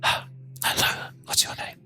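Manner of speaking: very soft, quiet whisper. Shown as a demonstration. Speaker demonstrates asking a neighbour quietly, whispering. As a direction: whisper a shy little greeting. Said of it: "So quietly, you gotta whisper it".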